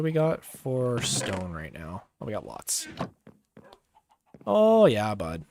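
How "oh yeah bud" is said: put-on Canadian accent. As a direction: belt it out like a hearty Canadian. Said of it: "Just bust out the Canadian accent".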